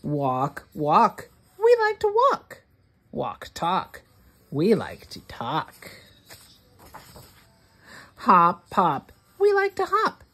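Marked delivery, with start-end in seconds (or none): 1.6-2.6 much higher, rushed chirpy line; high fast chirp. Acted. Reader to child, high quick chirp. 9.4-10.2 high chirp. Acted.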